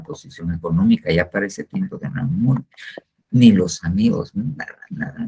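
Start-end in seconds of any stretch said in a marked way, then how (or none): 4.3-5.3 nada, nada whispered under the breath, high; breathy whisper. Real, not acted. Preacher to his flock, nothing mattered, whispered nada, nada. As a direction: let nada, nada fall to a hushed whisper.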